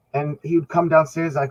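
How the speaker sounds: ordinary talk, nothing marked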